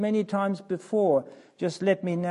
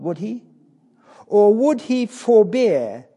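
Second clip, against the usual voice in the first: rising, louder pulpit preaching; impassioned preaching; 4 semitones higher, 5 dB louder than usual. Acted. Preacher pressing Christ's choice on the congregation, fervent. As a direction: drive the question home to the congregation.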